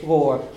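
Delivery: gore with a closed O. Shown as a demonstration. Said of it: "It's a closed O".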